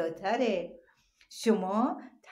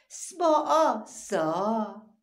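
سباع سا stretched very slowly and louder; drawn-out syllables; 5 dB louder, much slower than usual. Shown as a demonstration. Teacher sounds out سباع سا slowly and louder for children. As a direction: drag out سباع سا. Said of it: "سباع چسبان سه".

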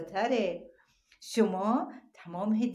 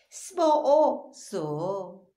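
سباع سو stretched very slowly and louder; drawn-out syllables; 6 dB louder, much slower than usual. Shown as a demonstration. Teacher sounds out سباع سو slowly and louder for children. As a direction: drag out سباع سو. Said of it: "سباع چسبان سه".

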